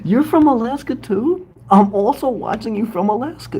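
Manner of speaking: high, bright excited disbelief; thrilled. Real, not acted. Host thrilled a new viewer is from Alaska, high excitement. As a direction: squeal it like you can't believe it.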